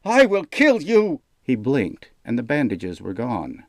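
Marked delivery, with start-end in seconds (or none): 0.0-1.2 high, loud threatening yell; furious threat. A narrator's reading. Jaden threatens the insulting wizard, furious yell. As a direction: roar it with rage.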